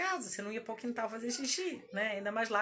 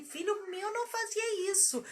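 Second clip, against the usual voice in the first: sniffy mother-in-law; 8 semitones higher than usual. Retold or imitated.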